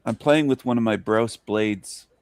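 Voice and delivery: ordinary talk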